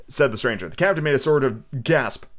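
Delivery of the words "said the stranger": ordinary delivery, nothing marked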